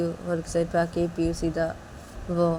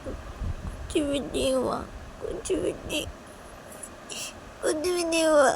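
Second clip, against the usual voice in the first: high, drawn-out little voice; small, high voice on the edge of tears; tearful child; 10 semitones higher, 4 dB quieter, slower than usual. Acted. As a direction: whimper it, about to cry. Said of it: "ये बोल के रोने लगती है".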